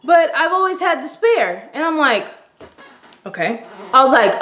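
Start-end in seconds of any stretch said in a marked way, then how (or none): 0.0-1.7 put-on boyfriend defending. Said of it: "He's like".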